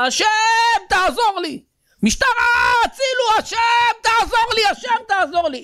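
desperate, very high-pitched screamed plea; crying out in prayer. Acted. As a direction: scream to God as if being robbed. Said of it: "אם אנחנו ככה נצעק בתפילה".

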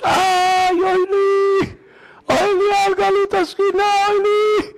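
very high, long mock wail; wailing lament. Son imitating the rabbi's midnight crying, high wailing lament. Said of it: "חצי שעה בוכה".

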